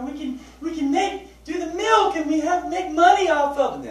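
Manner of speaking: high, hammed-up sales pitch; mocking the excuse for keeping the goats; mock enthusiasm. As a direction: parody the family's eager plan. Mocking the goat plan.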